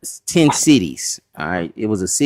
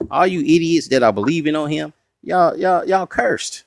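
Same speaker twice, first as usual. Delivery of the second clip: higher-pitched mocking scorn; scornful Pharisee; 7 semitones higher than usual. Pharisees to the crowd believing on Jesus, scornful. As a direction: look down on them in a raised pitch.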